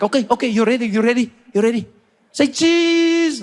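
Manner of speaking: high, building to a big cheese; seatmate. Retold or imitated. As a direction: count down the selfie eagerly. Seatmate counting down the selfie.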